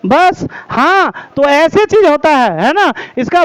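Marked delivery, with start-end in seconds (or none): 0.1-1.1 बस and हाँ stretched very slow and higher, pitch swinging on each; teacher showing sentence tune on बस, हाँ; intonation demo. Shown as a demonstration. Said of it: "शब्द अथवा वाक्य में सुर लहर".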